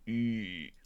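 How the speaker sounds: a tight smile sound through the teeth; cheesy grin noise; forced grin squeal. Shown as a demonstration. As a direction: show your biggest cheesy grin. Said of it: "give me your best smile".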